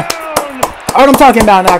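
ordinary talk

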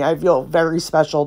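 ordinary talk, nothing marked

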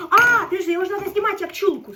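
loud, high burst of realization; sudden realization. Real, not acted. Cooking on camera, suddenly realizing how to skin the pike, excited outburst. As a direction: blurt it out as it dawns on you.

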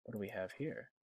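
ordinary talk, nothing marked

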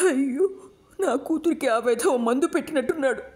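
high, wailing lament of a mother; starting to cry. A narrator's reading. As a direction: wail it, close to tears, pitch up. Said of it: "ఏడుపు ప్రారంభించింది రుక్మిణి". (కూతురి గురించి ఏడుస్తూ అయ్యో అని వాపోవడం)

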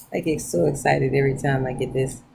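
ordinary talk

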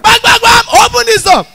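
high, loud banging yell; armed robber shout. Retold or imitated. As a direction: pound the door and roar. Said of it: "Armed robbers".

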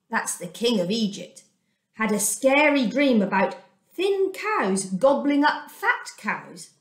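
plain reading voice

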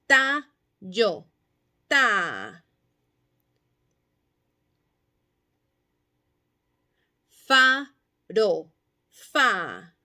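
"Tallo" y luego "Ta", fuerte y marcado; palabra y sílaba inicial. Shown as a demonstration. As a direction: decir palabra y cortar la primera sílaba. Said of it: "Aislar la sílaba inicial". (maestra modelando sílabas iniciales)

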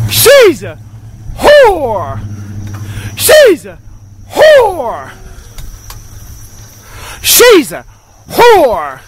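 high, loud, repeated shout; shouted insult. Shown as a demonstration. Man in car shouting the same insult over and over. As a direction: bellow it, testing the tone each time. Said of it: "I'm trying to say it with the right perfect tone".

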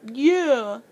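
mumbled through a mouthful; muffled mumble, mouth stuffed with food; mouth full. A narrator's reading. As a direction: say it around a mouthful of tater tots. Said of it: "he mumbled with his mouth full of food".

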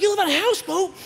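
high amazed question. Retold or imitated. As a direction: marvel at it. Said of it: "I'm like".

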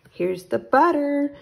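high, loud happy shout; excited shout. Acted. Boy shouting to his mother about the butter. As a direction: call out the butter proudly. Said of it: "shouted Jack".

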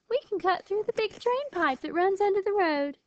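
high-pitched, confident dismissal; scoffing. A narrator's reading. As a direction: brush off their worries, pitched well up. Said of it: "Lily scoffed".

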